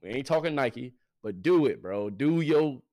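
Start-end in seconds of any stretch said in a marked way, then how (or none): none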